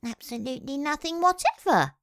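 perfectly truthful. A narrator's reading. The Mole honestly answers the Rat, perfectly truthful. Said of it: "replied the Mole with perfect truthfulness".

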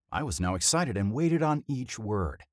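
plain reading voice

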